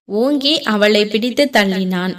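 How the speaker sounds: ordinary delivery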